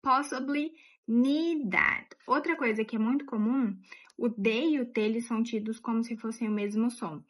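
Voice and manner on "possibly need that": "Possibly need that" said slowly and higher, weight on the first O; slow model read, stressing the O in "Possibly"; slow model phrase. Shown as a demonstration. Teacher modelling the stressed phrase slowly. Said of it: "a parte forte no O".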